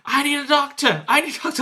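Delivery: high, urgent mock cry for help; calling for a medic. Casualty calls for a doctor, high mock plea.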